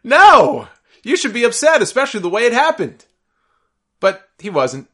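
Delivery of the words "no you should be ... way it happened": high loud outburst, no!; outraged shout. Real, not acted. Host shouting at Sheamus for not being upset, outraged yell. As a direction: yell at him that he should be upset.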